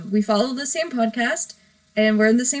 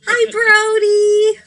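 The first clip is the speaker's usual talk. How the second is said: high, loud, drawn-out hello; excited greeting; 10 semitones higher, 7 dB louder, much slower than usual. Real, not acted. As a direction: sing out the greeting, stretched long. Drawn-out greeting to stream guest.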